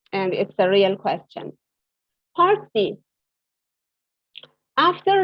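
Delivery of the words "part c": usual speaking voice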